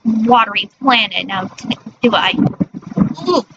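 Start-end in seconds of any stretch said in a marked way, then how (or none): none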